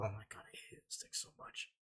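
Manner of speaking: very low-volume whispered grumble; under his breath. Real, not acted. Venting about the hated underwear, whispered. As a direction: whisper it to yourself, exasperated.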